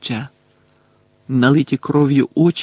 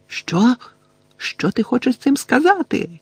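much higher, startled question; fearful cry; 10 semitones higher than usual. A narrator's reading. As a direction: cry out with fear creeping in. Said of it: "крикнула вона, і вперше в її голосі забринів страх". (наляканий високий вигук)

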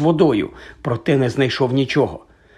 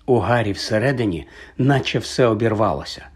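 usual speaking voice

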